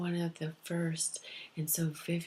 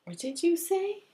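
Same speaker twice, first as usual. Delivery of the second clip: high, raised mock questioning; spirits calling out; 12 semitones higher, 5 dB louder than usual. Spirits asking what she said, high called-out question. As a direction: ask it high, like calling across. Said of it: "And they're going".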